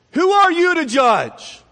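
high, loud, drawn-out retort; mock-offended holler; indignant challenge. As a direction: throw it back as a slow, outraged shout. Acts people objecting to being judged.

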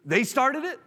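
high-pitched, mock kid's protest; childish blame. Preacher mimicking a child blaming others, childish blame. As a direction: whine it up high like a blaming kid. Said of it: "You think just kids say that".

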